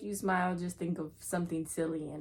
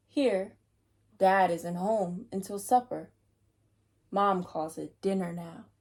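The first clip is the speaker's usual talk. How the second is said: put-on reading to children, louder and paced; story narration; 5 dB louder than usual. A narrator's reading. Reader reads the book aloud to children, steady storytelling voice. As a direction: read each line with care.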